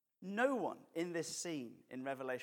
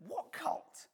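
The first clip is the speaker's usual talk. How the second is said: high-pitched incredulous question; mock surprise; 13 semitones higher than usual. Bystander asking the other about the cult, mock surprised. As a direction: imitate a startled listener, pitch jumping up.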